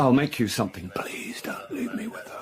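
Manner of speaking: breathy pleading whisper, near tears; desperate whisper. Acted. Son begging his wife in a hushed whisper. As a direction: whisper it so the mother can't hear.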